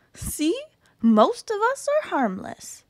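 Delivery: high, chirpy cartoon germ; cute germ voice. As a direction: brightly reassure like a tiny germ. Germ reassures reader.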